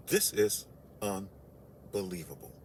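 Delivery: deadpan disbelief. Retold or imitated. Retold reaction to guard.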